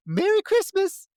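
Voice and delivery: high, loud, cheery greeting; a gleeful holiday cheer; mock chipper. Mocking the final girl's cheer, high and gleeful. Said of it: "she's like".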